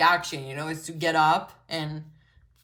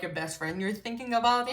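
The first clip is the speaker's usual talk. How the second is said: ordinary delivery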